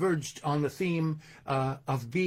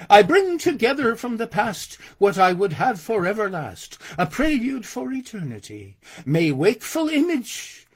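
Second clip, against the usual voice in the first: raised, projected verse delivery; poem recitation; 4 semitones higher, 5 dB louder than usual. Acted. Violinist psalming like King David to audience, declaimed recitation.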